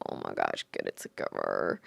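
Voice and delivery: deep, strange self-scolding voice; a deep mock voice telling herself to pull it together; scolding inner voice. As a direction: tell yourself off in a deep put-on voice. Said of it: "is like".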